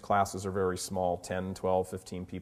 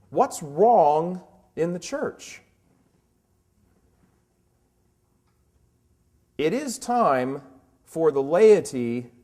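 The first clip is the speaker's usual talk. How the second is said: preaching; 4 semitones higher, 6 dB louder than usual. Acted. Fervent rhetorical church critique.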